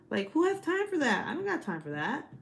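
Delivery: overworked wife, exasperated outburst. Real, not acted. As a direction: throw up your hands. Exasperated rant.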